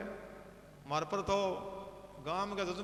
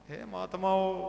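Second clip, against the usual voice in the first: ordinary delivery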